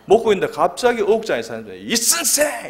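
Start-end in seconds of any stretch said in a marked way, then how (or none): 1.8-2.7 high, loud imitated holler; calling out. Colleague yells for the preacher in a karaoke bar, mock holler. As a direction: call over a noisy karaoke room. Boss calls over noisy karaoke.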